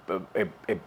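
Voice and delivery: usual speaking voice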